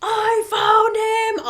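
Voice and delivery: high, loud holler; retold triumphant call-out. Finder alerts cops searching far away, re-enacted high holler. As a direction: re-enact shouting the discovery to everyone.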